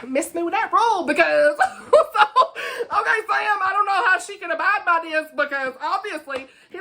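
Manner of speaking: high-pitched talking through laughter; losing it laughing. Real, not acted. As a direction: try to keep arguing while cracking up. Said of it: "I'm losing it".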